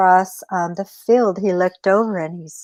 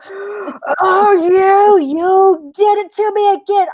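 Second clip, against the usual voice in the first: gasping, high indignant coyote; hammed-up betrayed cry; acted outrage; 11 semitones higher, 4 dB louder than usual. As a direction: burst out at the trickster. Coyote discovers the trick.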